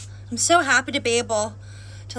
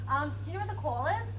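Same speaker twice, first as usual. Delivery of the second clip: polite question; 4 semitones higher, 8 dB quieter, faster than usual. Real, not acted. Asking neighbor for help.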